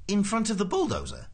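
high-pitched disbelieving question; incredulous official. A narrator's reading. Prosser checks the bulldozer part with Ford, high disbelief. Prosser queries Ford's absurd request.

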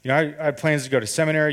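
tumbling out in a heated rush; rushed rant. Retold or imitated. Venting at his girlfriend about his seminary plans, fast rant. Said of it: "I'm going ninety miles an hour".

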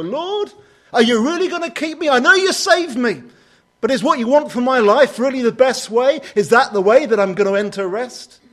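high, loud, worried plea to God; a believer pleading loudly with God, anxious. Retold or imitated. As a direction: cry out your doubts to the Lord, high and pressed. Voicing his own doubting prayer.

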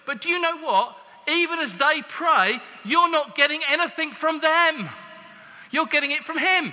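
high, loud preacher's emphasis; preaching at full force. Acted. Preacher telling the congregation where the power comes from, forceful. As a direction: press it home to the congregation.

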